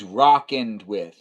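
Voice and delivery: "rockened" drawn out, ROCK-end, loud; odd pronunciation. Shown as a demonstration. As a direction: slowly stress "rockened" with an AH vowel. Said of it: "You like to use".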